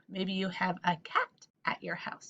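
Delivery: Maybe you have a cat at your house breathed as a whisper; whispered repeat. Shown as a demonstration. Teacher repeats the cat line to kids as a soft whisper. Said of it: "whispered repeat".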